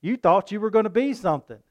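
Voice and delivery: mocking classmate. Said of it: "and people say".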